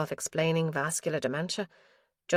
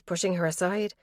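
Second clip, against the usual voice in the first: plain reading voice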